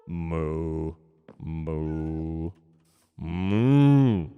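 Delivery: low, drawn-out moo; long low imitation cow. Shown as a demonstration. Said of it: "have you meowed and mooed".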